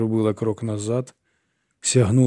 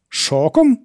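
high, loud rising echo of the word; startled echo; 11 semitones higher, 6 dB louder than usual. A narrator's reading. Myerson repeats Irene's word in alarmed disbelief. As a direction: snap the word back in disbelief.

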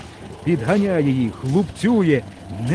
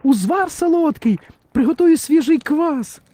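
much higher, squeaky little figure; high story-character voice. Acted. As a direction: voice a small fairy-tale character up high. (високий голос казкового героя)

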